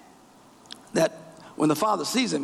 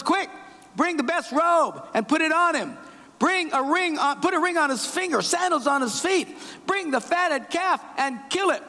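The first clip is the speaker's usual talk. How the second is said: quick, eager commands, pitched high with delight; a father bursting with joy, calling out orders; overjoyed father; 10 semitones higher than usual. Acted. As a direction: voice climbing high in glad haste. Father joyfully welcomes son home.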